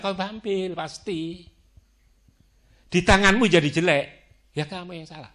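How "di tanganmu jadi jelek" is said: loud, forceful declaration; emphatic. Real, not acted. Preacher booming the point at the congregation, loud. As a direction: hammer the point home to the crowd.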